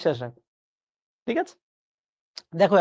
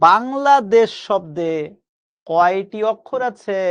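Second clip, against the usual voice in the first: slow teacher question; slower than usual. Shown as a demonstration. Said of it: "একটু খেয়াল করে দেখেন তো".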